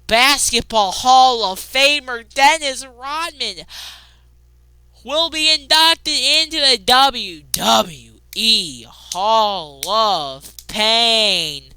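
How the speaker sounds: slow, drawn-out dramatic announcement; slow announcer voice. Acted. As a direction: read it like a grand ring announcer.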